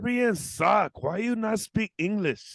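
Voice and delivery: high, slow mock local accent; slow, high put-on accent; mock broken English. Cab driver scolding the foreigner, slow high put-on accent.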